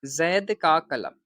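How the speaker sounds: slow, deliberate dictation of the example. Shown as a demonstration. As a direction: say "जैद का कलम" slowly, as if writing it down. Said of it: "चलिए जैद ही लिख देते हैं".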